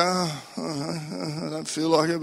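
soft, whiny reluctant groan; reluctant moan. Retold or imitated. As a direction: sulk like you'd rather be anywhere else. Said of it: "And you go".

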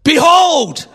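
"Behold" cried out high and loud, like a herald; "Behold" as a loud, high proclamation; proclaimed Behold. Shown as a demonstration. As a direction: announce "Behold" with full force. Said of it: "You've got to say".

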